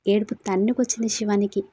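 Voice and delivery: plain reading voice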